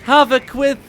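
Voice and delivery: excited esports casting; live play-by-play. Acted. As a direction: call the match fast and hyped.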